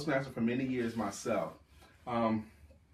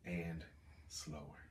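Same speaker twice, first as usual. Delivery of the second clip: low, soft, spaced-out persuasion; lower and slower; 7 semitones lower, 14 dB quieter, slower than usual. Shown as a demonstration. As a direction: drop low and quiet, pausing between words. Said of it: "you should talk lower".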